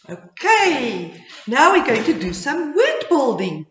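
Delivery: ordinary talk, nothing marked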